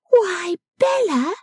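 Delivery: high, drawn-out cry of surprise; astonished exclamation. A narrator's reading. Lavinia eyeing Bella's bonnet, exclaiming in astonishment. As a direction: gape aloud in a high voice. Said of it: "struck in with".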